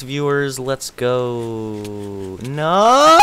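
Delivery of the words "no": high, loud protest; loud playful protest. Real, not acted. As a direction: yelp it in protest.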